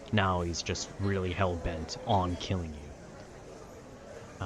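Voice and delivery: ordinary delivery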